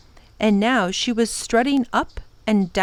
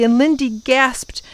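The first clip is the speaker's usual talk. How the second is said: ordinary delivery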